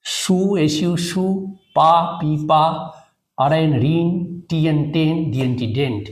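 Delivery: measured chunk-by-chunk spelling drill with short pauses; spelling by syllables. Shown as a demonstration. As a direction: spell each chunk, then sound it out. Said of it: "স্পেলিংটা এরকম করে করবে". (Syllable ভাগ করে বানান করে বলা)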